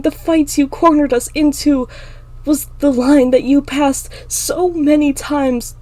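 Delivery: high, loud, outraged questioning; yelled demand. Acted. Emma demands Clinton explain attacking her sister, yelled demand. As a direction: build the demand, slowing for the final question.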